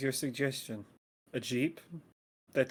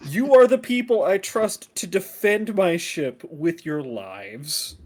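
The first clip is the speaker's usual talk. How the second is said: mock solemn; 2 semitones higher, 8 dB louder, slower than usual. Captain's mock solemn speech.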